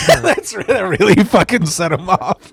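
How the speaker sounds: high, laughing through the punchline. Real, not acted. Laughing while ending the story to a friend. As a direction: crack up as you finish.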